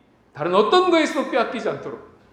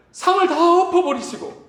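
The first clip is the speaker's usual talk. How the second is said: ordinary delivery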